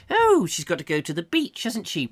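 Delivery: a hint of a snooty old lady; lightly voiced prim gossip; snobbish neighbour. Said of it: "I've got to get out of character".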